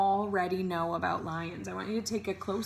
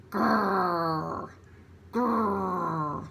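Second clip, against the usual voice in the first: growl. Shown as a demonstration. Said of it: "now I'll show you my growl".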